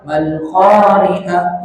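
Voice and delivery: slow "mal-qari'ah" with final h cut to a stop; stopped ending. Shown as a demonstration. Teacher showing a forbidden reading, stopped ending. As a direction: choke off the end of "mal-qari'ah", no breath. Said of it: "itu nggak boleh".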